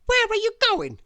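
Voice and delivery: very high, loud demanding lady; bossy high voice. A narrator's reading. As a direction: snap the question down from on high. Miss Bossy voiced in story.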